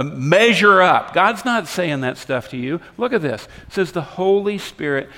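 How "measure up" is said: loud, high, drawn-out reproach; hectoring. Acted. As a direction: land the mock scolding slowly and hard. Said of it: "God is not harassing and hectoring you".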